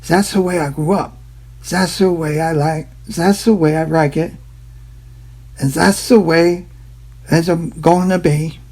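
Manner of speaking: put-on retries the joke accent after a miss; corrected mock Chinese accent. To viewers, a second try at the mock Chinese accent. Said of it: "No, no, no, it's so different".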